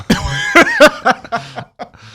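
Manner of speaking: high loud laugh bursting out; embarrassed giggle fit; burst of laughter. Real, not acted. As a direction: crack up at your own confession. Confessing he pantsed his mom.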